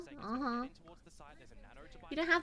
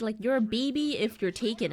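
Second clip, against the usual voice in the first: usual speaking voice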